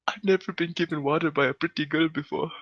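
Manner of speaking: high-pitched imitated Indian accent. Drunk scholar thanks girl for water, high Indian accent. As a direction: mimic an awed drunk man in an Indian accent. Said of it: "in his fucking Indian accent".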